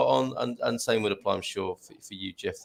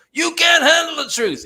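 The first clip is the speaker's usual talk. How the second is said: movie quote; 9 dB louder than usual. Retold or imitated. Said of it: "Is that Henry Fonda".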